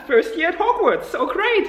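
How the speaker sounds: high, sarcastic cheer; laugh, then gush in fake delight; mock enthusiasm. Mocking cheer after a grim story.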